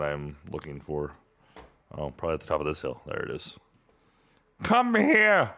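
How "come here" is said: calling out. Real, not acted. Chasing something in game.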